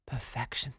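ordinary delivery

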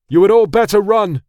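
loud, high-pitched yell; yelled warning. A narrator's reading. Prince warns soldiers before freeing stallion, yelled warning. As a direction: yell it out to everyone around. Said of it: "Fasim yelled".

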